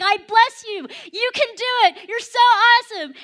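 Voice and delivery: high, very loud shouted blessing; hammed-up, full-volume pep talk; impassioned cheering. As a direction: cheer them on with all-out passion. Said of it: "like, really getting into it".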